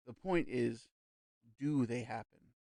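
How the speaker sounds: pause, then lean firmly on "do" in "do they happen"; measured lead-in, then "do" pressed home; sudden emphasis. A narrator's reading. Medium challenging a sceptic, emphasis on "do". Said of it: "put an unexpected emphasis into the little sentence".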